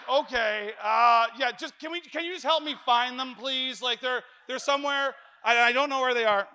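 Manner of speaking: loud, high exasperated retort; husband yelling at patient wife over lost keys; furious. Retold or imitated. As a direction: snap back at the wife, fed up. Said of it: "That makes me even more furious".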